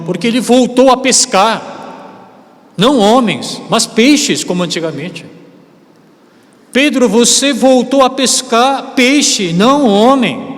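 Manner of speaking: high, loud and slow, pressing each word; preaching at full force; emphatic preaching. Acted. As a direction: hammer home the rebuke to Peter. Preacher rebukes Peter's return to fishing.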